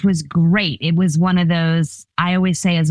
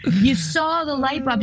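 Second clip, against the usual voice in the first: ordinary delivery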